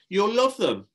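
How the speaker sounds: high, loud party-host pitch; eager children's entertainer voice; Shelley enthusing. Acted. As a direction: talk up the games to reluctant children. Shelley selling party games.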